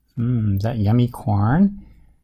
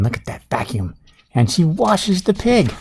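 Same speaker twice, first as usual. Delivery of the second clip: plain reading voice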